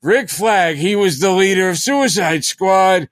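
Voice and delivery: high, hammed-up old fan voice, called out; mock old-timer. Studio execs mocking old fans, in a doddering high old-man voice. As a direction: play a fond old geezer reminiscing, overdone. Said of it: "Like going".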